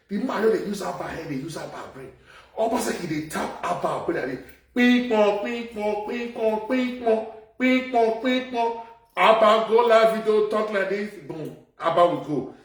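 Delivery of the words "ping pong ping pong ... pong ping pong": chanted ping pong, higher; rhythmic mock sound effect; mimicked ping pong. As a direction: bounce it like a ball.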